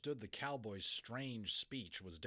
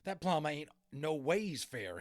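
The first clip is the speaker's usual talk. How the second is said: loud, slow cowboy grumble; a sulky cowboy complaining; grumbling cowboy; 5 semitones higher, 8 dB louder, slower than usual. A narrator's reading. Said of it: "he muttered".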